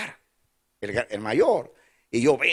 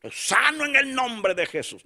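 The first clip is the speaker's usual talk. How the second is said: mock faith declaration; 10 semitones higher than usual. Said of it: "terminal y digo".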